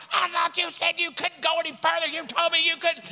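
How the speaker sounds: high-pitched, outraged mock scolding; chief berating. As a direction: throw the accusation at him, hammed up. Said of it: "And the chief is like".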